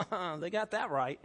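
laughing agreement, pretending to be heartless; mock cruel parent. Imagined parent answering a hurt child, mockingly laughing. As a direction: chuckle through a mock parental put-down. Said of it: "And then for you to go".

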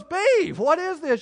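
high, loud mock-mom cry of alarm; mother's shocked call. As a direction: call out like a mom spotting dirt. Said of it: "And she goes".

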